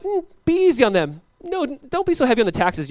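high-pitched mock pleading of elders; hammed-up old men's plea, pitched high. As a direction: plead like worried old men. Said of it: "All the old men are like".

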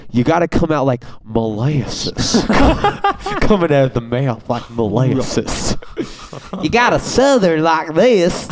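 hammed-up Southern drawl imitation. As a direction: stretch it out like molasses, Southern. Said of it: "Andy when he's like".

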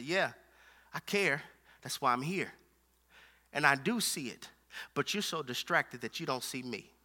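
low, soft, measured answer; calm reassurance. Acted. Jesus answers Martha's complaint face to face, calm reassurance. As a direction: drop right down, quiet and patient.

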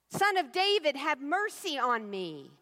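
a desperate call that won't be shushed. Acted. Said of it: "He gets louder".

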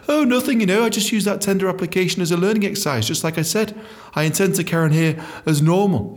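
a touch of high, breezy fake innocence. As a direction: act nonchalant, lightly mocking.

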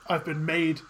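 ordinary talk, nothing marked

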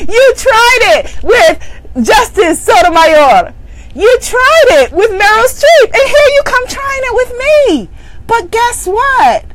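sing-song scolding of the inner critic; mocking greeting to the devil. Coach to audience, taunting the self-doubt devil, high and loud. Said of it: "You can say".

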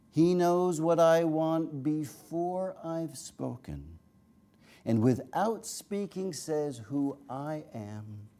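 slow, high incantatory verse, softening at the close; poetry reading. Acted. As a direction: intone each line high, then let it fall. Reads a poem aloud.

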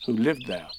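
usual speaking voice

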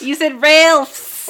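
slow, loud mock Chicago accent; "Ralph's" stretched out in a mock Chicago accent. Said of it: "making fun of my Chicago accent".

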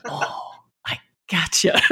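whispered private realization; soft whispered aside; inner thought whisper. Retold or imitated. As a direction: breathe it as a thought to yourself. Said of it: "And in my mind, I was like".

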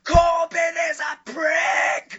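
very high, slow, tearful screaming; unhinged yelling. Retold or imitated. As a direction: scream it like he's losing his mind. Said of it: "He was just yelling".

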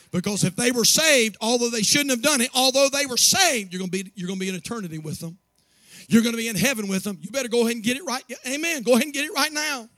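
loud, rising sermon climax; full-force preaching. Acted. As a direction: drive it home to the congregation.

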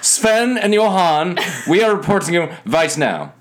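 high, laughing mock Swedish-Russian accent. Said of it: "That was maybe too Russian".

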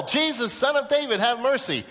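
high, urgent appeal; crying out. Acted. Bartimaeus asks Jesus to heal him, crying out. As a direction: call up to him for help. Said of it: "He asks that he will".

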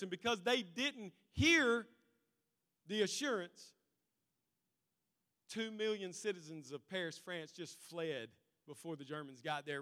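ordinary talk, nothing marked

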